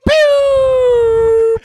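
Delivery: high loud pew shout; mock gunshot shout.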